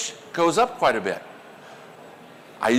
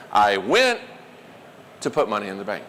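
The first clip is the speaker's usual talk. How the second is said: emphasis demo with went standing out; stress on went; 9 semitones lower than usual. Shown as a demonstration. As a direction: hit the word went, keep the rest low. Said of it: "punch words for emphasis".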